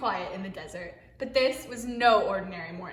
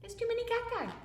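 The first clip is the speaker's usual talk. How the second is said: excited; 13 semitones higher, 4 dB quieter, faster than usual. Real, not acted. Mock overwhelm.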